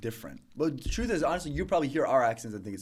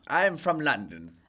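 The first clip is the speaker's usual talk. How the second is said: attempted London English accent; 2 semitones higher, 3 dB louder, slower than usual. Guest claims to be a Londoner, attempted London accent. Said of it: "Where are you from?".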